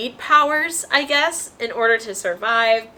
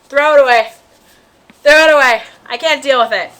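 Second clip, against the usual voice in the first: loud, hammed-up cry to get rid of it; louder, mock panic; mock panicked outburst; 4 semitones higher, 11 dB louder than usual. Reader retelling her reaction to the scary sequel, loud mock panic. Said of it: "and I was like".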